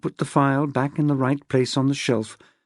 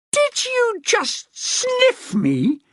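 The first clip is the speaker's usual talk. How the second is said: much higher, slow shrieking outrage; shrieked; much slower than usual. A narrator's reading. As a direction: shriek it, appalled. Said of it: "she shrieked".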